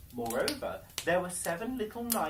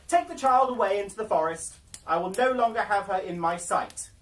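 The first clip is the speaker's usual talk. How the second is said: loud, high, cold royal command; commanding wicked queen; 5 semitones higher, 10 dB louder than usual. Acted. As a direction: bark the order like a jealous queen. Wicked queen orders huntsman.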